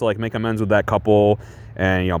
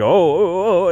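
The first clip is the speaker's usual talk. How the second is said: suggestive moaning; 8 semitones higher, slower than usual. Retold or imitated. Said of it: "kind of making noises".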